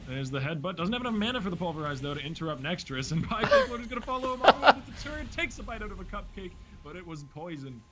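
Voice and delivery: animated match commentary, jumping up in pitch; live play-by-play. Real, not acted. Caster calls a chase under the turret for viewers, high excited play-by-play.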